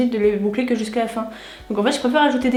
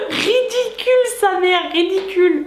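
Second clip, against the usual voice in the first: high squealing laugh; 8 semitones higher than usual. Real, not acted. Laughing at the stocking look.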